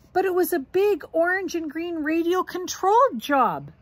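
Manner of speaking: high, loud excited protest; eager protest. Acted. Brother defending himself to papa, high and eager. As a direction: plead the plane's case.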